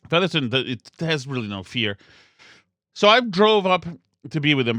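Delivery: ordinary talk, nothing marked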